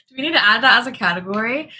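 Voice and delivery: laughing question. Real, not acted. Host laughing at her viewers' joke, fast and giggly.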